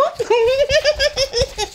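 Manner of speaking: long, high-pitched giggle; high squealing laugh. Real, not acted. Vlogger laughing at her own joke, high squealing giggle.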